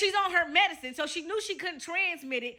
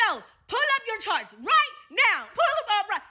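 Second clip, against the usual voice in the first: yelling; 7 semitones higher than usual. Retold or imitated. Angry boyfriend bursts in, yelling at her for her records. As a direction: bark the demand like an angry boyfriend. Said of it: "he comes in yelling".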